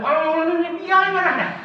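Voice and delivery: very high put-on voice; mocking impression. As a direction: squeak it out as a teasing caricature. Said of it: "aku lihat". (tirukan orang yang sedang marah dengan suara tinggi dan mengejek)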